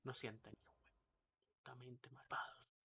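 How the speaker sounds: hushed Spanish whisper; mock-secret whisper in Spanish; joking whispered aside. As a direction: whisper it slyly as a secret joke. Sly Spanish aside, then laughs it off.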